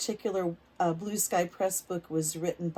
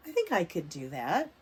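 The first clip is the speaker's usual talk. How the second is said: ordinary delivery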